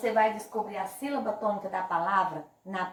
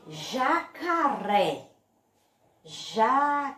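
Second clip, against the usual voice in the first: "jacaré" very slow, split up, RÉ strongest; slow syllables, forceful final "ré" in "jacaré"; stress on final syllable; much slower than usual. Shown as a demonstration. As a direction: break up "jacaré" and push hard on the last syllable. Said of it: "a sílaba que eu botei mais força pra falar".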